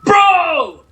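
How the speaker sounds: loud high yell of disbelief; real exasperated shout; frustrated shout. Real, not acted. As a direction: bellow at the screen. Fails the sequence again.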